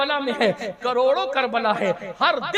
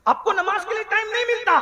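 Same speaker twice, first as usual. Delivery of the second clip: pitched-up, incredulous challenge; high reproach; 7 semitones higher than usual. Real, not acted. Preacher to those without time for prayer, high reproach. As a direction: lift it high as an accusation.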